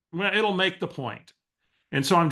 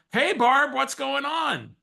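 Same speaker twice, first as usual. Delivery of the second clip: high-pitched, drawn-out phone greeting; feigned familiarity; 11 semitones higher, slower than usual. Retold or imitated. Answering an unknown caller as if he knows her, high feigned familiarity. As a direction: greet them as if you know exactly who it is.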